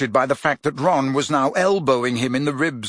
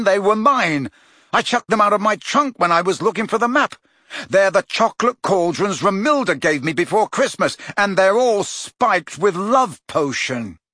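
louder, raised exasperated voice; 6 semitones higher, 3 dB louder than usual. Acted. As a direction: explain it in frustration. Harry exasperated explaining.

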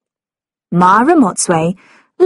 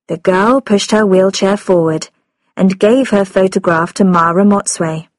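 ordinary delivery

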